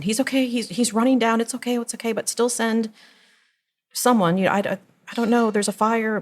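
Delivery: high, flustered reassurances; retold basket case. Mother on the phone to emergency operator, flustered. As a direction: reenact a flustered emergency call. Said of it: "I was a basket case".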